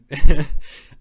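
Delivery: a loud burst of laughter; giggling hard at his own joke. Real, not acted. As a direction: crack up loudly at the joke. Laughing at sixty-nine joke.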